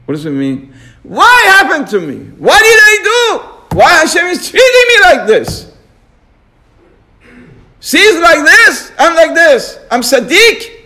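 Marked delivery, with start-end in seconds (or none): 1.0-10.7 high, loud aggrieved whining; whining complaint. Retold or imitated. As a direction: complain like someone wronged by fate. Voicing complaining people.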